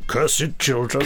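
slow, hammed-up panto baddie; pantomime villain. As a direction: drag it out like a stage villain. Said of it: "That's sounds like a pantomime villain".

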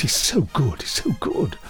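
ordinary talk

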